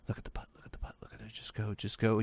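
barely audible urgent whisper; hushed coaxing. Real, not acted. Gamer willing the ball into the hole, hushed coaxing. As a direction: coax it along under your breath.

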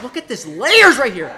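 ordinary delivery

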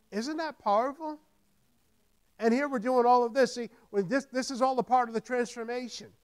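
high, loud preaching with repeated build; fired-up preacher exhorting the congregation; preaching at full force. Acted. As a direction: drive the point home to the church.

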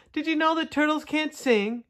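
high-pitched call to those below. A narrator's reading. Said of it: "He called down to the other turtles".

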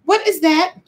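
high, startled outburst; shocked. Retold or imitated. Coming home to find a dog in the house, high and shocked. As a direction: react like you just saw the dog. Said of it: "I'm like".

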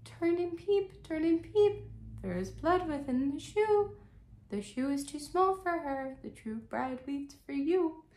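high, slow sing-song bird voice; high chanting pigeon rhyme; pigeons crying out. Acted. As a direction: pitch way up like a cooing pigeon. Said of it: "sat the two pigeons and cried".

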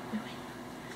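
breathy whispered promise. Real, not acted. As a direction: whisper it conspiratorially. Said twice, second whispered.

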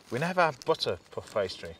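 normal talking voice

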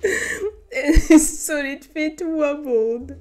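reading through helpless laughter, voice high; breathless giggling through the lines; laughing while reading. Real, not acted. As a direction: try to read but keep cracking up. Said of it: "I'm sorry".

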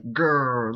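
short playful "grrr"; mock Hulk growl. Streamer likens himself to the Hulk, mock growl. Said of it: "do you ever watch the Hulk?".

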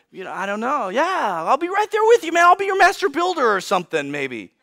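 climbing high, then dropping away at the end; tentative young Moses. Acted. Moses offers to serve future Pharaoh Ramses, high then deflated. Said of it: "Moses would be like".

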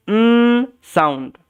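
"উম" as a long held nnn, then "sound"; N sound. Shown as a demonstration. Teacher showing the sound for pronouncing N, held nnn. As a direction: hold the bare consonant n in "উম", no vowel. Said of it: "কি sound?".

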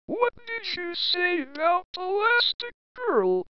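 slow, drawn-out teasing question; slow suspicious question. Acted. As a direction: stretch each word, savoring the gossip.

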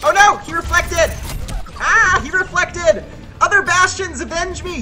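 high, loud hammed-up dying cry; overacted death scene. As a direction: ham up a heroic death. Dying after reflect, hammy plea.

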